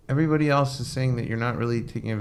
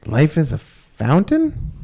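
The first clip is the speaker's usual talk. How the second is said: slow, higher and louder, rising in disbelief on "fountain"; baffled question; 6 semitones higher, 7 dB louder, much slower than usual. Retold or imitated. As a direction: repeat it back as a puzzled, incredulous question, "Life is a fountain?". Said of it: "And the guy goes".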